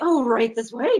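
fawning hurry to escort her; eager ushering. Retold or imitated. Staffer rushing the late actress to set, eagerly loud.

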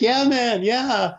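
high, uncertain going-along voice; awkward agreement. Retold or imitated. Confused friend humouring a hugging stranger, high and awkward. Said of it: "And Philip's like".

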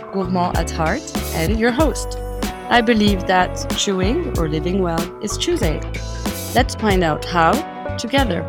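bright announcer-style intro read; podcast intro. Acted. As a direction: welcome listeners like a radio host. Podcast intro read over music.

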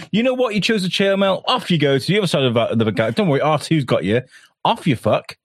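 high mocking Luke voice. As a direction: sneer it out as a smug Luke, pitched high. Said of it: "He's, he's like".

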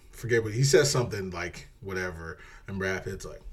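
normal talking voice

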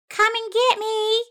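high, loud sing-out taunt. A narrator's reading. Hidden mouse dares the cats to chase her, high taunting call. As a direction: goad them in a high, loud voice. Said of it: "she taunted".

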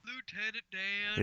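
slow, very soft Forrest Gump drawl. Acted. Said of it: "Come on, like Forrest Gump".

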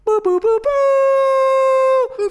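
high loud mock fanfare. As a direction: belt it like a jingle. Sound effect fanfare before announcements.

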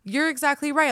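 high, loud wide-eyed agreement; mock amazement. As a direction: act suddenly enlightened. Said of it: "But I'm always like".

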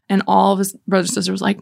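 ordinary talk, nothing marked

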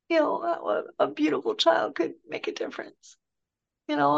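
imitating his tearful voice. Father retelling his loss through tears, mildly imitated. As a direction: lift the voice as if holding back tears, lightly acted. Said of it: "and he starts crying".

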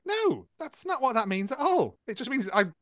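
mock-outraged rebuttal; acted exasperation. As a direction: argue back, climbing into indignation. Acting out exasperated reply to accuser.

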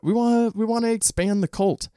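high singsong joke pitch; playful cult recruiting. Pretend. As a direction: lift it high, like a mock cult invitation. Jokingly recruiting listeners to a cult.

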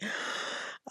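a sharp, startled intake of breath; mock shocked gasp. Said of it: "And I'm like".

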